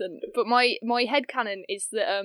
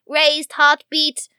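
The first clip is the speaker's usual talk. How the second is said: slow, raised, measured machine voice; high, deliberate robotic announcement, item by item; computer readout; 5 semitones higher, 4 dB louder, slower than usual. Retold or imitated. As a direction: read it out like a ship's computer. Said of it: "as the computer reads out".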